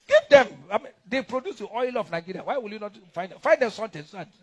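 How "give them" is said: much higher, louder call; emphatic demand. Real, not acted. Demanding oil money for Rivers State, a loud high call. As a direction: shout the demand out.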